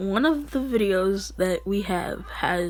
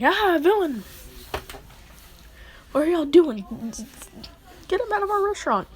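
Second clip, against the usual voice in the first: acted alarm; 8 semitones higher than usual. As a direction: raise the alarm, climbing high and hurrying at the end. Waiter panics at seeing villain.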